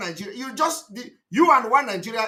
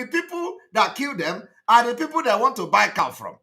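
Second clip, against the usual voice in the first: ordinary delivery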